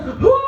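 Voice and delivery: high-pitched, hammed-up last-breath wail; mock dying wail. Old patient dying as the nurse punches in, hammed-up wail. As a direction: let out a long, high mock death cry. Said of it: "all I hear is".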